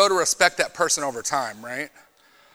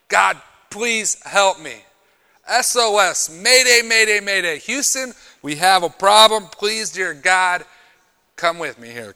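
desperate prayer; 3 semitones higher, 8 dB louder, slower than usual. Acted.

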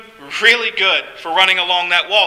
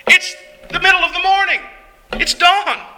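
high-pitched, baffled protest; perplexed; 9 semitones higher than usual. Acted. Woken man protesting the early hour to his friends, perplexed. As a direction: protest in disbelief, voice pitched well up.

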